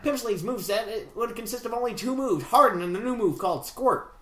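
put-on old-time radio interviewer patter; twenties gangster voice. Reading Relish Ram's email, twenties gangster voice. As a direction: talk like a vintage mobster. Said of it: "twenties interviewer voice".

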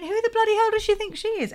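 high-pitched comic outrage; mock indignation. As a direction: squeal it as an affronted objection. Voicing indignation at a character's nerve.

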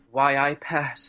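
a mock Geordie "Why I pet" in [name]'s voice; [name] Geordie impression. Drag queen asked for her best [name], mock Geordie. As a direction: put on [name]'s Geordie lilt. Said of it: "embody [name] for a moment".

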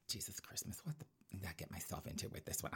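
whispered aside, unvoiced; exasperated whisper. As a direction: mutter to yourself in despair.

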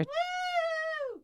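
high whooping cheer. Real, not acted. Cheers her own shift.

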